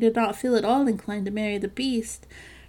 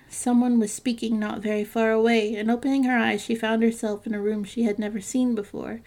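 usual speaking voice

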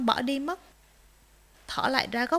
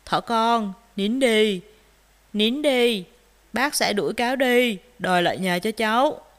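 louder reassuring bear voice; kindly bear; 2 semitones lower, 6 dB louder than usual. A narrator's reading. Bear comforts crying rabbit, kindly reassuring. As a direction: console and promise help firmly. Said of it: "bác gấu ân cần bảo".

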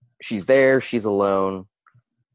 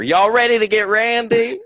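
high, loud Texas twang, laughing; Texas accent; 12 semitones higher, 8 dB louder than usual. Retold or imitated. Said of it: "This is my impression of a Texas girl".

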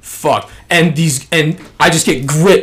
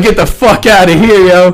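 laughing refusal; 6 semitones higher, 8 dB louder than usual. Retold or imitated.

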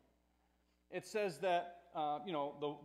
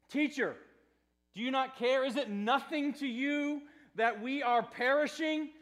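raised, louder reproach to a sleeping teacher; accusing plea; 5 semitones higher, 6 dB louder than usual. Acted. Frightened disciples waking their teacher in the boat, accusing. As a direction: press the question as a pained accusation. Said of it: "they make this accusation".

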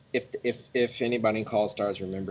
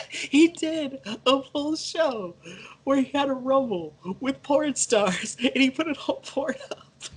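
laughing; 4 dB louder than usual. Real, not acted. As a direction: talk through laughter. Laughing while telling story.

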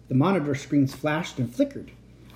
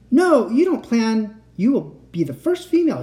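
eager insistence; 9 semitones higher, 6 dB louder than usual. Acted. Eager uncle pressing niece.

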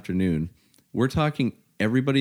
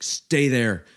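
louder, higher mock yell down a hallway; retold yell; 4 semitones higher, 5 dB louder, slower than usual. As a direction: call it out as a sharp command. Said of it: "yells down the hallway".

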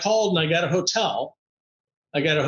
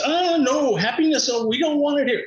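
higher-pitched voice of a city refusing a visitor; mock-alarmed city; 8 semitones higher than usual. Washington turning happiness away, mock-alarmed. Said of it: "Washington says".

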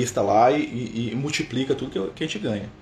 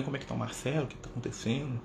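gentle caring friend; 11 dB quieter than usual. Retold or imitated. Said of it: "O Lucas vem".

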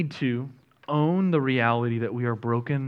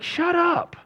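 crowd hushing. Acted. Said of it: "everybody's like".

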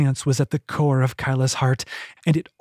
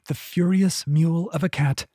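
usual speaking voice

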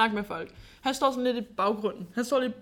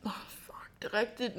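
hushed, whispered realisation; under the breath; 9 dB quieter than usual. Real, not acted. Friend remembering about drawing on people, whispered. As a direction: whisper it as it dawns on you.

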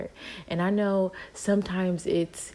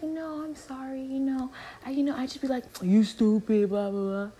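mocking coworker voice; 8 semitones higher than usual. Coworker excuses herself.

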